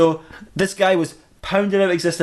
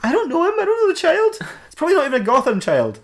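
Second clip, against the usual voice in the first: very high, squeaky excuse; hammed-up guilty protest; defensive whine; 13 semitones higher, faster than usual. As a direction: shrug off blame in a raised voice. Batman excuses the child's death.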